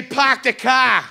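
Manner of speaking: slow, drawn-out Boston "Park the car"; hammed-up Boston accent. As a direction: drop the r like a Bostonian. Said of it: "tease people from Boston".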